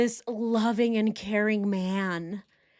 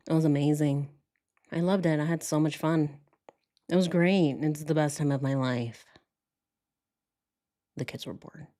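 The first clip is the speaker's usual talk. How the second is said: lower, flat, sighing imitation of the husband; mock monotone; 4 semitones lower than usual. Mocking how the husband describes his children, flat monotone. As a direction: read it dead flat and bored. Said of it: "he's so monotone".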